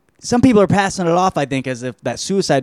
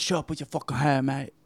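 put-on British accent; 11 dB quieter than usual. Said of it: "Robert Pattinson".

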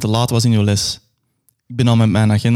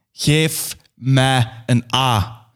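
1 slow final call; much slower than usual. Acted. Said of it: "En tenslotte roep ik".

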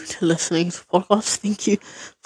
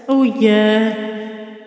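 echo effect; much slower than usual. Real, not acted. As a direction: voice drenched in digital echo. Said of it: "I also love this echo".

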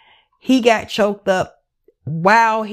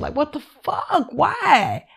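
real tears in the voice, higher and breaking; choked up; 7 semitones higher, slower than usual. Real, not acted. To viewers about the girl's remains, choked up and tearful. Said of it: "I'm getting choked up".

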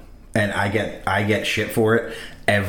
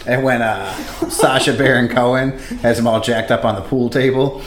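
ordinary talk, nothing marked

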